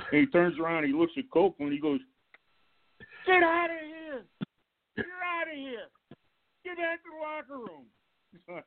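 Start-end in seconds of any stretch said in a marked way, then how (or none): 3.3-8.6 mock coach yell. Coach yelling at late player.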